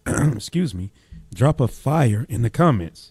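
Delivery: hoarse, worn voice. Real, not acted. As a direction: voice going hoarse. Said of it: "losing my voice".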